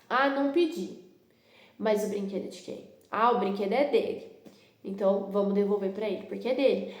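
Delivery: ordinary talk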